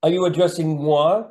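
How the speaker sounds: playful mock-haughty question; mock posh. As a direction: tease with a fake posh air. Jokey mock-posh reply to host.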